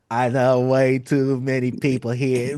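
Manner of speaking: monotone, slow sung-rap delivery. Acted. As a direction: hold one note and drag the words out evenly. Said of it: "that monotone [name]".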